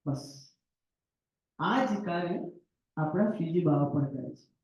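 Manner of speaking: ordinary delivery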